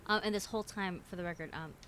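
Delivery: ordinary talk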